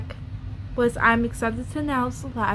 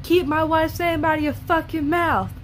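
louder, higher, yelling the line; yelled quote; 5 semitones higher, 3 dB louder than usual. Retold or imitated. Imitating Will Smith yelling at Chris Rock from his seat. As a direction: shout it like the angry husband. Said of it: "Yelling it".

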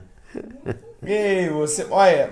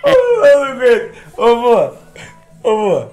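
laughing; 5 semitones higher, 6 dB louder than usual. Real, not acted. Laughing exclamation.

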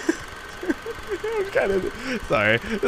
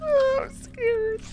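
high, drawn-out scared voice; slow, high little whimper of fear; 3 dB quieter, slower than usual. Pretend. Player spooked by the game, scared high voice.

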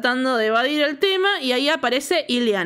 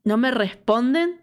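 ordinary delivery

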